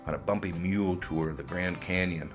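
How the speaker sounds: ordinary talk, nothing marked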